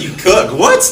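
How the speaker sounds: shocked disbelief; surprised. Retold or imitated. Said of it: "They was like".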